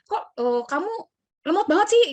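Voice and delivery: hesitant start, then high, loud jab; mock exasperated criticism. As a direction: act out a critic, jumping up in pitch and volume. Imagined critic scolding her slowness.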